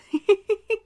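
high, loud amused laugh. Acted. Amused by her lover's wrong word, a loud high laugh.

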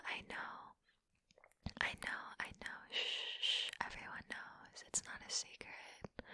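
hushed teasing whisper. Acted. Whispering to tease her partner, hushed. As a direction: whisper it close and soft.

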